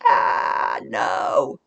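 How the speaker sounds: sudden shouted no; mock horrified shout. Shown as a demonstration. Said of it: "do not sit there and look down and read".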